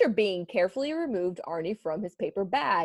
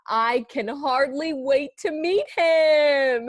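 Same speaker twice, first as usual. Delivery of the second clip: eager donut voice; 7 semitones higher, 4 dB louder, slower than usual. Acted.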